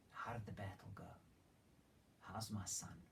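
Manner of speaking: whispered, anxious question. Retold or imitated. King David waiting for news of his son, whispering. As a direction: breathe the question out in worry.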